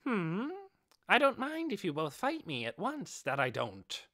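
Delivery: high, bright-eyed beast voice with a quaint "that I don't"; squeaky hamster beast. Acted. Giant hamster taunting the armoured warrior before the fight, high and eager. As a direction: play the proud giant hamster itching to fight.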